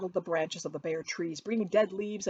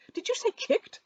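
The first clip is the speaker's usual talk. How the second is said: much higher, louder, outraged question; indignant Collins; 6 dB louder than usual. A narrator's reading. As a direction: squeak it in affront.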